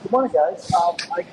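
off mic, distant and thin; turned away from mic. Real, not acted. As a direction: voice far from the phone. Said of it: "It sounds like you're off mic there".

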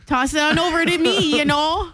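playful exaggerated Midwestern accent. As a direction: bat it back in Minnesotan. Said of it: "love the Midwestern accent".

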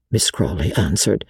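ordinary delivery, nothing marked

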